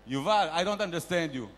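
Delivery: ordinary talk, nothing marked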